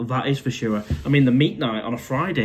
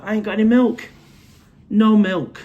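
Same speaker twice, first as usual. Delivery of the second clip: higher and louder, hammed-up outrage; exaggerated comic despair; mock dismay; 8 semitones higher, 6 dB louder than usual. Real, not acted. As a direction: play up the disaster of no milk. Dramatic no-milk lament to viewers.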